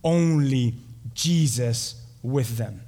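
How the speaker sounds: ONLY JESUS WITH THEM very slow, word by word; emphatic highlighting. Shown as a demonstration. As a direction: spell out "Only Jesus with them" with weighty pauses. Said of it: "highlight this".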